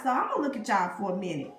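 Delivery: ordinary delivery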